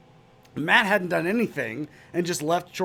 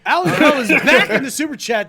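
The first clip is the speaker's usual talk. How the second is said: loud, high, laughing announcement; excited shout-out; 10 semitones higher, 11 dB louder than usual. Real, not acted. Host calling out a super chatter loudly, laughing. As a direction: hype up the returning fan.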